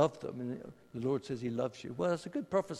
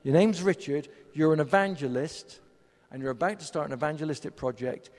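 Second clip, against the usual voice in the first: much louder, firm declaration of Your name's Richard; loud confident prophetic word; bold loud proclamation; 9 dB louder than usual. Retold or imitated. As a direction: call it out boldly to the room. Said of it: "it's either Richard or it's not".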